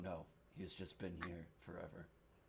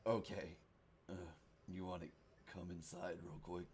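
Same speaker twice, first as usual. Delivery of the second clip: hushed; 10 dB quieter, faster than usual. Acted. Drunk man hushes visitor.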